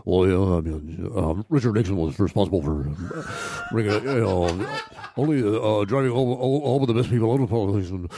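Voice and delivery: put-on low, mumbling old man who never finishes a sentence; Hunter S. Thompson. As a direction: trail from fragment to fragment in an incoherent low mumble. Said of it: "Hunter S. Thompson as, like, a sixty".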